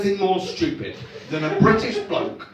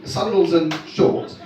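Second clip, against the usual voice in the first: ordinary talk, nothing marked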